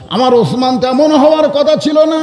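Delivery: high, chanted lament; tearful lament. Acted. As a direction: plead it high, as if weeping. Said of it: "রাসূল কান্দার বলে".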